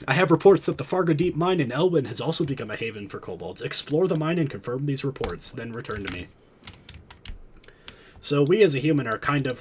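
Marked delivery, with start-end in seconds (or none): 0.1-6.3 quest text read-out. Acted. Reading quest giver text.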